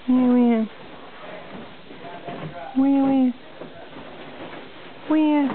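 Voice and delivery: high, bouncy repeated where. Real, not acted. Playful baby game.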